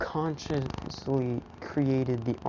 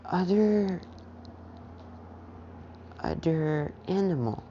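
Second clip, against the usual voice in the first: put-on toddler voice; 5 semitones higher than usual.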